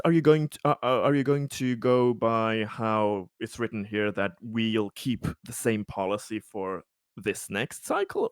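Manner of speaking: usual speaking voice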